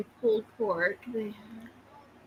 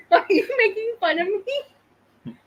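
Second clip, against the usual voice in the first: ordinary delivery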